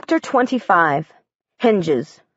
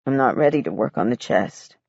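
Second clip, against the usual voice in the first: subdued; 8 semitones lower, 4 dB quieter than usual. A narrator's reading. Weary grieving father.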